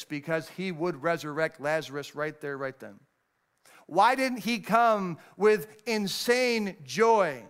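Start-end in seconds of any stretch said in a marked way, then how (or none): 3.9-7.4 slow emphatic question. Real, not acted. Preacher building emphasis.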